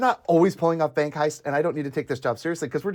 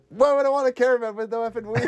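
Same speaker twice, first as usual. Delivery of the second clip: high, fast, laughing disbelief; incredulous mock rant; 10 semitones higher, faster than usual. Quoting the caravan scene, a high fast laughing mock rant. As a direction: fire it off in amused disbelief. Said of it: "He's like".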